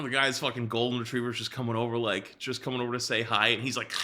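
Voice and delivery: usual speaking voice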